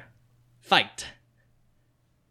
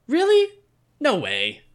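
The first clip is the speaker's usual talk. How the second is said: surprised; 10 semitones higher, 4 dB louder than usual. Acted. Mikey amazed at Billy's story.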